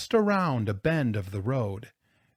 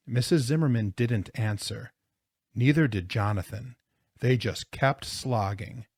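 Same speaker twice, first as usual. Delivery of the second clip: usual speaking voice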